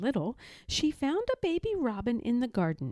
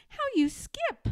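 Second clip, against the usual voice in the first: much higher, delighted cub; laughing delight. A narrator's reading. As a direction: squeal it through a laugh. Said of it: "Little Bear laughed".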